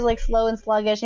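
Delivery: usual speaking voice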